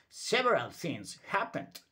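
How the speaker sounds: ordinary delivery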